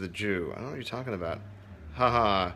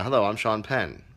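normal talking voice

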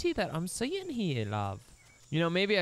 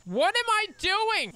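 much higher, louder, slow squeal; bewildered; 6 dB louder, slower than usual. Real, not acted. Gamer lost in the dino game, high bewildered squeal. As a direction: wail in confusion.

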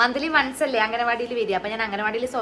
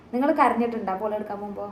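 ordinary talk

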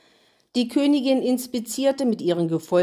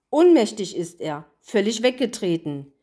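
ordinary delivery

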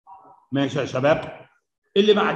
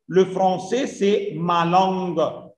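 slow reading; much slower than usual. Shown as a demonstration. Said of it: "teacher models French sentence".

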